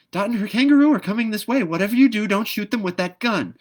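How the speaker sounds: very high, loud and fast chatter; shrill little bird warning at full pitch; frantic bird scolding. A narrator's reading. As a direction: scold in a panic to stop the gun. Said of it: "fuss and scolding ... excitement".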